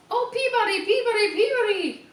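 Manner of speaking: high sung birdcall Peabody, Peabody; high, loud birdsong voice. A narrator's reading. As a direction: sing it out like a little bird.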